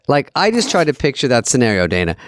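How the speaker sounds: ordinary talk, nothing marked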